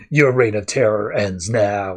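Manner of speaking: lightly voiced Batman, low and deliberate; a hint of Batman.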